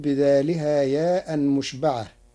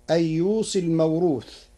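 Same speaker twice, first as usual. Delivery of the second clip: usual speaking voice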